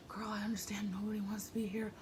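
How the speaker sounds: soft unvoiced whisper; hushed girl-to-girl aside; confiding whisper. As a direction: whisper it like a shared secret. Imagined confiding to the singer.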